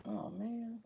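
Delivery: ordinary delivery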